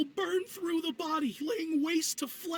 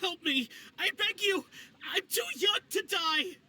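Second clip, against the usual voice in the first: pleading cry, rising to a shout; panicked begging; 3 semitones higher than usual. Acted. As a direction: beg for your life, near tears. Begging to lift a curse.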